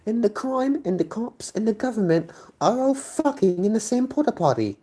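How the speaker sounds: very high, squeaky put-on voice; sarcastic offer in exaggerated falsetto; mocking falsetto. Streamer mocking the move-abroad argument, shrieky high falsetto. Mock voice offering other countries.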